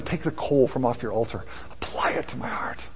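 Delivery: softly voiced plea, sinking to a whisper; hushed prayer. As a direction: pray it low and hushed.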